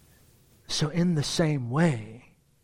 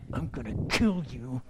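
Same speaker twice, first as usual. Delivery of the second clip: mock threat; 4 semitones lower, 4 dB quieter than usual. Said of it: "you're like".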